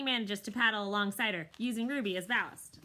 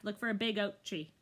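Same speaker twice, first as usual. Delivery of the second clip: ordinary delivery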